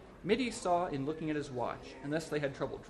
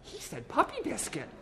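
high-voiced woman, tickled; high, amused female character; amused passer-by; 11 semitones higher than usual. A narrator's reading. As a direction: tell a friend the silly thing just overheard. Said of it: "A woman who passed laughed".

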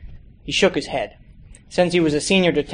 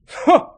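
scoff; 14 semitones higher, 4 dB louder than usual. Acted. Bitter scoff.

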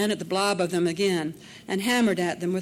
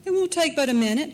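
quick, higher, louder reassurance; brisk reassurance; 8 semitones higher, 6 dB louder, faster than usual. A narrator's reading. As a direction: brush it off briskly. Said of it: "he said".

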